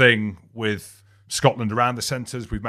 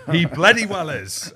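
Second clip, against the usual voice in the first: laughing; 7 semitones higher, 6 dB louder than usual. Real, not acted. Laughs mid-sentence, emphatic.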